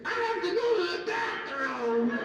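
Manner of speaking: high, slow, drawn-out bathroom plea; mock prissy request. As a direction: stretch it out like a fussy guest. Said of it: "Some people say they go like that and say".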